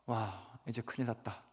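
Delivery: soft dismayed sigh. Retold or imitated.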